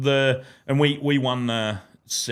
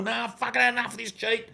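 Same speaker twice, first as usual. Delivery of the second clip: retold yell; 10 semitones higher, 5 dB quieter than usual. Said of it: "I'm yelling into the microphone".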